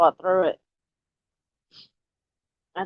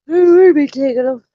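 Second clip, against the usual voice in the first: calling out; 7 semitones higher, 10 dB louder than usual. Real, not acted. Calling to someone elsewhere.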